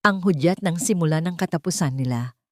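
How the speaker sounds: usual speaking voice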